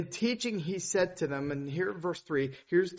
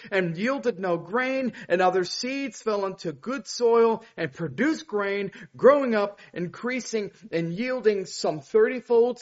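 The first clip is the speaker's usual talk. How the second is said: loud, high preaching proclamation; 4 semitones higher, 5 dB louder than usual. Acted. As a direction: proclaim the parable with fervor. Reads the parable aloud.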